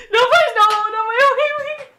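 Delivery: high, loud hammed-up begging; high, loud pretend panic; mock pleading. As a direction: beg for mercy, overacted. Mock pleading after apologies.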